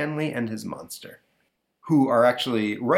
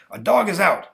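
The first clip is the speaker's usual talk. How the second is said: stern dad; 10 semitones higher, 6 dB louder than usual. Acted. Father voiced.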